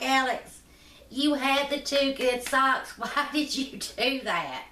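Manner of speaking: put-on teasing the boy while cracking up; laughing nurse. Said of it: "she's like".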